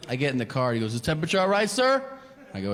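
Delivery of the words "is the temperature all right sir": polite chauffeur. Retold or imitated. Polite driver voiced.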